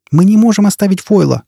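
высоко и громко, удар на «можем»; кричит в отчаянии, не отступая; крик. A narrator's reading. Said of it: "крикнула Джизбелла". (отчаянный крик)

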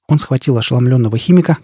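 ordinary delivery, nothing marked

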